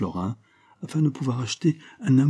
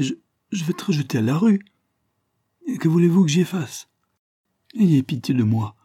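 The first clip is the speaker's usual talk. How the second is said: much higher, stammering, tearful begging; sobbing plea; 8 semitones higher than usual. A narrator's reading. Desperate young woman begging the fat man for pity, sobbing. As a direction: sob the words out, voice catching on each je. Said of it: "sanglota la malheureuse".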